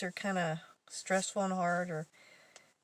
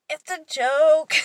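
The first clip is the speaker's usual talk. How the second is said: much louder, higher and slow; laughing reveal; 8 semitones higher, 13 dB louder, much slower than usual. Real, not acted. Teller confesses the prank to coworker, loud slow laughing reveal. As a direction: burst out with the punchline.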